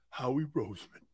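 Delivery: low, soft, reverent mock awe; mock fawning. Shown as a demonstration. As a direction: say the name in a hushed, worshipful mockery. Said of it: "There's a way of doing it instead of going".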